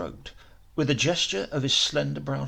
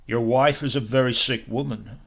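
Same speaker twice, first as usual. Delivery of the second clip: blunt, louder statement; abrupt declaration; 2 semitones lower, 8 dB louder than usual. Acted. Doctor warning the professor about his wife, abrupt and louder. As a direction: drop it on him bluntly. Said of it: "he declared abruptly".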